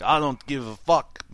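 mock German accent, barely there; a lame try at a tough German guy; bad German accent. Friend mocking a movie punk in bad German accent. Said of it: "that was my German accent".

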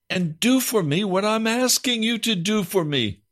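high, impassioned cry to God; pleading prayer. Acted. Preacher crying out to the Lord in prayer. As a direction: beg the Lord for deliverance.

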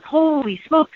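high, amazed gasp of a husband; imitating husband's sudden wonder; husband on the phone spotting the rainbow, amazed; amazed exclamation. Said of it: "he goes".

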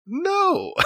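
squeaky high laughing no; high-pitched laugh on a denial. Real, not acted. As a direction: blurt a no and break into a laugh. Wry denial then laugh.

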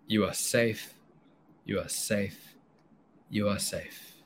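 soft, soothing reassurance; calming voice. Acted. Oxytocin calms the frozen brain people, soft and soothing. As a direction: soothe them like a frightened child. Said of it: "Oxytocin's calming voice".

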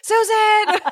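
high, laughing cheer; excited cheer. Real, not acted. Host celebrates Susan, excited laughing cheer. Hosts cheer the named wife.

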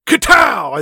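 high, loud shouted bang noise; imitated gunshot. Imitating the bang Madeline hears, shouted sound effect. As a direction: burst out with a mock gunshot. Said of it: "in the background, she hears".

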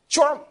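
sharp, high, loud imitated snap of a bite; snake bite sound. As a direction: punch out the snake strike noise. Snake bites, sound effect.

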